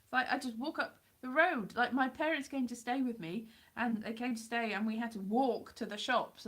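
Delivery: ordinary delivery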